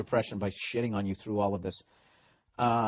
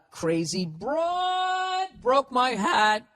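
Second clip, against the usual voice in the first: high, drawn-out mock melodrama; Sex and the City chick; 13 semitones higher, much slower than usual. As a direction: wail it slowly like a TV heroine. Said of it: "It sounds like that fucking chick from".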